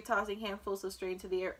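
plain reading voice

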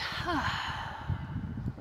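long voiceless sigh out; exhaling sigh. Shown as a demonstration. Meditation guide showing listeners how to let it out, exhaling sigh. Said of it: "Let it out".

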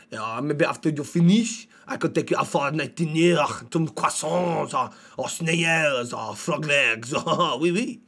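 hammed-up French accent with chuckles. Shown as a demonstration. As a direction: flirt in a thick mock French accent. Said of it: "Let's go French".

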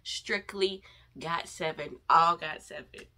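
ordinary talk

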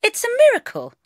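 high, loud, triumphant; proud proclamation. A narrator's reading. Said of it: "I said proudly".

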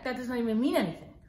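ordinary delivery

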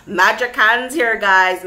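loud, slowed brag about magic hands; proud exclaim. Real, not acted. Reader bragging to viewers about her pull, loud and proud. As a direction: show off, loud and pleased.

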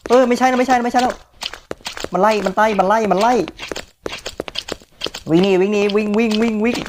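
high, frantic chant to run; real fright as it gives chase; panicked yelling. Real, not acted. Player chased by a monster, frantic high panic.

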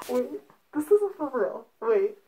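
loud, stunned disbelief; can't believe it, blurting out; shocked disbelief. Real, not acted. Disbelief at positive test.

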